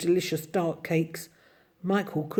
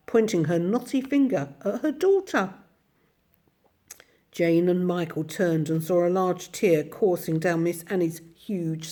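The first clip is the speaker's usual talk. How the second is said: plain reading voice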